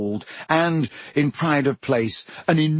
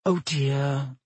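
soft, regretful sigh of words; mild, sorry tone; sad; 3 dB quieter than usual. A narrator's reading. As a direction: say it with gentle dismay. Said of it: "said Hermione sadly".

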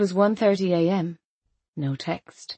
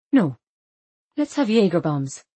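ordinary delivery

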